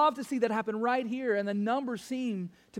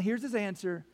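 normal talking voice

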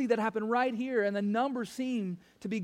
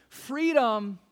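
ordinary talk, nothing marked

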